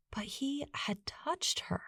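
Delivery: slow hushed whisper; awed whisper. A narrator's reading. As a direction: breathe it in wonder, slowly. Narrator hushes key moment.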